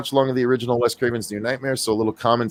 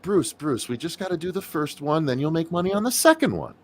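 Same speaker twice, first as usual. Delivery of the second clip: mock pleading pitch; 6 semitones higher, 6 dB quieter than usual. Mocking pitch to Bruce.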